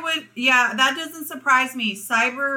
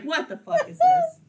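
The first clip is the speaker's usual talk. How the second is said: much higher squeal of disbelief; stunned disbelief. Retold or imitated. Recalling her reaction to the video, a squeal of disbelief. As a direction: squeal it like you can't believe your eyes. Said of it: "I was like".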